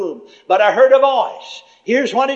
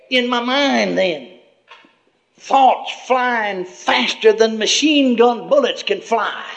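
ordinary talk, nothing marked

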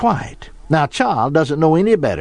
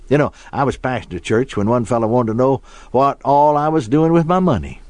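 normal talking voice

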